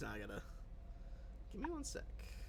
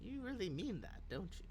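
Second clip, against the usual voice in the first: put-on higher, softer old woman, doubting; probing old woman; 6 semitones higher, 7 dB quieter than usual. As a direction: test whether they're serious. NPC tests their sincerity.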